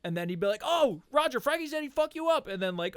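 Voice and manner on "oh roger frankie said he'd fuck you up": very high, loud goading call; instigating. Retold or imitated. Buddy winding up two teammates, high instigating taunt. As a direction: egg them on with a high-pitched tattle. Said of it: "the king instigator".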